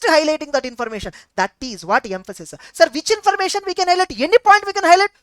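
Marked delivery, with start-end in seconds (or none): 2.7-5.1 high imitated question, louder at the end; questioning student. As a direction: lightly act an eager student asking. Acts out a questioner addressing sir.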